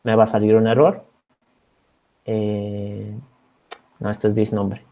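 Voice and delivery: ordinary delivery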